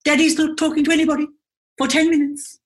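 mock dad brushing off his kids; mocking self-important dad. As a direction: send up the dad who won't talk. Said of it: "I say to my children".

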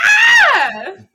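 sudden loud, high shriek; scared scream. Retold or imitated. Friend retelling the swamp tour alligator scare, loud scream. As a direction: re-enact a startled scream. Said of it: "That shit was scary".

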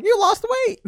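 very high relative voice; confused relative. Retold or imitated. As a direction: squeal the compliment. Relative to father.

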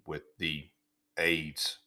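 ordinary talk

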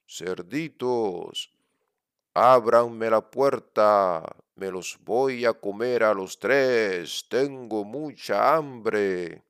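slow, loud hungry wolf howl. Acted. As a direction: howl and threaten, drawn out. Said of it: "aullando así".

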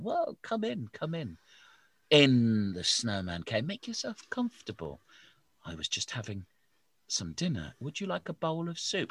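high, soft, welcoming teacher; gentle teacher voice. Acted. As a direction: coax the guest in kindly.

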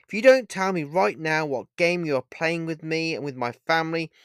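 high, heartbroken threat with murderous rage. Acted. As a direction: threaten him through heartbreak. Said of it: "heartbroken tone of voice, tinged with an almost murderous rage".

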